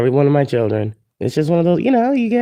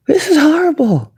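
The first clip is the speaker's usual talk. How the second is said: outraged cry. Retold or imitated. Recalls watching the movie alone, outraged high cry. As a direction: shriek it in retold disgust. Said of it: "and I was like".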